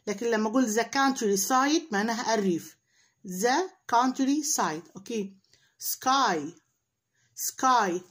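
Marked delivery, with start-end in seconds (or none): 3.3-4.9 drawn-out teacher model; slow model pronunciation. Shown as a demonstration. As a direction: stretch each syllable slowly. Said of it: "احنا ممكن نحفظها كلمة كلمة".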